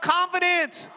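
shout. Shown as a demonstration. Preacher leading the congregation in a call, shouted response. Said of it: "Someone shout".

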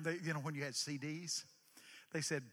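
normal talking voice

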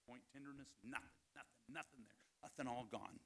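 very soft examining murmur; retold doctor's under-the-breath findings; hushed checking. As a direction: murmur as if testing an arm. Wife checking the healed arm.

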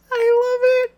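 gleeful, high-pitched delight. Real, not acted. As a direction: squeal with delight. Delighted reaction to scene.

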